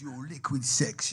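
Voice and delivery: repeating the compliment in his voice; slow imitation of Daddy Dyer. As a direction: savour liquid slowly as the old man. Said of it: "He goes".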